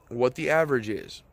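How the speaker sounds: ordinary talk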